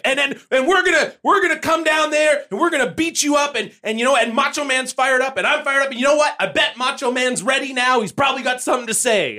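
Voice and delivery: high excited wrestler rant; Sting promo impression. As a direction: ramble like a nervous wrestler. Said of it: "And so Sting is like".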